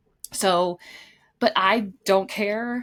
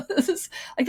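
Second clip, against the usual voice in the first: laughing; 10 semitones higher than usual. Real, not acted. Laughing at the slow connection.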